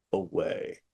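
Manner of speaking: usual speaking voice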